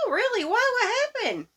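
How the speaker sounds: mild mock childlike excitement; eager child. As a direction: perk up hoping for good news. Little girl awaiting good news.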